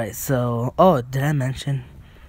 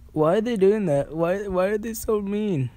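whiny; 7 semitones higher than usual. Real, not acted. Whining at unfair players.

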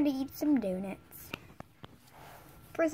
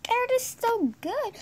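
ordinary talk, nothing marked

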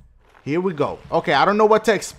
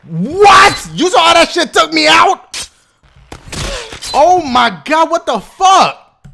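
high, loud, slowed disbelief; shocked outrage; 7 semitones higher, 5 dB louder, slower than usual. Real, not acted. Gamer stunned by a crash, shocked. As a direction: stretch out the outrage.

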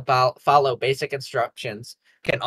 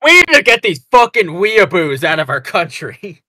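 bigoted ranting voice; 3 semitones higher, 6 dB louder than usual. Pretend. Mock bigoted speech.

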